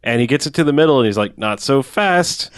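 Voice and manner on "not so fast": slow, drawn-out villain line; mock villain. Retelling the evil boss stopping a mouse, mock villain. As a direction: stretch each word to halt someone.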